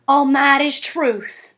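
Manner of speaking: slow Southern drawl; Southern accent. Shown as a demonstration. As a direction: stretch the vowels out, Southern style. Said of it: "pronounced more like an oo".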